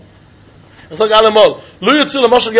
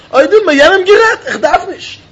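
raised pitch, arguing the other side; high-pitched objection; 8 semitones higher than usual. Acted. Student argues he need not yell, high-pitched objection. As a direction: counter the first side with a high, insistent objection. Acted second side of the argument.

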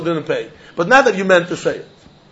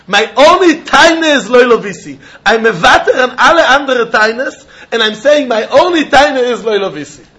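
insistent defendant; 5 semitones higher, 5 dB louder than usual. Retold or imitated.

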